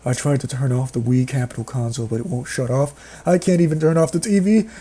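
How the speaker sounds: ordinary talk, nothing marked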